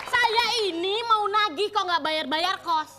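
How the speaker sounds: normal talking voice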